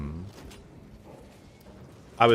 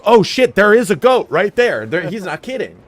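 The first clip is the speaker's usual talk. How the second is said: surprised riders; 9 semitones higher, 8 dB louder than usual. Retold or imitated. Riders react to the goat.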